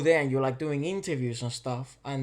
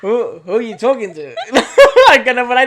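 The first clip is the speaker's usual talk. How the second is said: laughing; 7 semitones higher, 15 dB louder than usual. Retold or imitated. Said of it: "And I was like".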